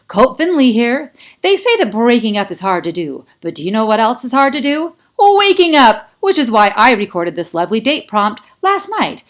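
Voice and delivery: loud, high announcer voice, hammed up; a DJ booming over the speakers; loud radio host. A narrator's reading. Colt greets the students over the bell speakers with a loud, high DJ voice.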